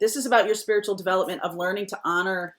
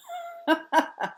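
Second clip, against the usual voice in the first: laugh; 10 semitones higher than usual. Real, not acted. Laughs after spooky demo.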